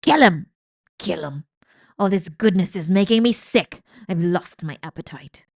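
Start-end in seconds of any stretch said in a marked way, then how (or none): 0.0-0.5 very loud demand; shout. A narrator's reading. Old rat demanding the kill. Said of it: "shouted Botticelli". 2.0-3.8 disgusted rant. Acted. Rat rants.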